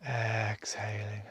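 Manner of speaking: slow whisper on the breath out. Acted. Breath cue during meditation.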